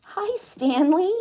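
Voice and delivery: pet talk. Real, not acted. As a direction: draw out the hello up high to the pet. Greeting the pet.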